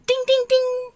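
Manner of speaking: high, ringing dings; imitated bike bell. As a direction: chime like a child's bicycle bell. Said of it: "playing with the little bell".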